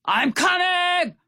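high, carrying call across the water. A narrator's reading. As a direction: call out to them from the boat. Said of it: "Stout Sam called".

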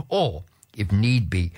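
usual speaking voice

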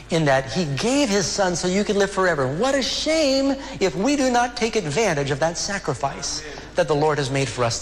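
high-pitched, urgent pulpit voice; impassioned preaching. Real, not acted. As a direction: preach it with rising fervor. Preaching at raised intensity.

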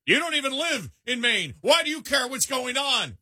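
high, mocking sing-song, pitched well above his own voice; mocking outsider. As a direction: sneer it like a smug outsider, way up high. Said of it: "somebody national saying".

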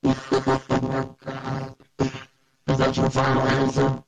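glitchy robotic mic cut-out; robotic digital distortion. Real, not acted. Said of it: "You just sounded like a robot".

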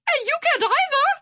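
quick, very high squeal; fearful screech. A narrator's reading. Swordfish answers back to his captain, screeching with fear. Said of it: "Swordfish screeched with fear".

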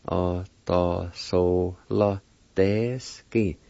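"Autosola deski" very slowly, in separated syllables. Shown as a demonstration. Teacher repeating the word for pendulum, very slow syllables. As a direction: stretch out "Autosola deski" part by part. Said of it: "it's pronounced like this".

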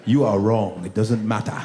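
put-on low, dismissive accented father; strict dad. Dad overruling his children, low and authoritative. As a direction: rule on it like an immigrant dad. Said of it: "one of those dads that's like".

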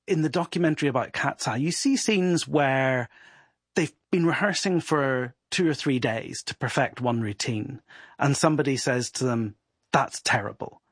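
ordinary delivery

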